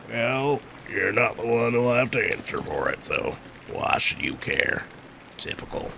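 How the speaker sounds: soft, low grumbling retort; sardonic monk. Acted. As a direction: grumble it under your breath, trailing away. Voices a game character's retort.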